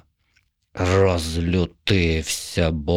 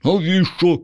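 put-on high, wary little boy voice; a suspicious child asking; 10 semitones higher, 3 dB louder than usual. A narrator's reading. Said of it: "насторожився той". (насторожений високий дитячий голос)

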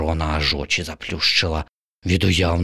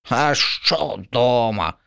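slow, higher sour crow; grimacing crow; 6 semitones higher, much slower than usual. Acted. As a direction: sneer at home. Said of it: "скривилася ворона".

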